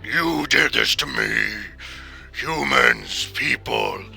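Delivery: low gravel vigilante growl; slow, loud snarling shark voice; deep gravelly voice. Acted. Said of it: "deep, gravelly voice".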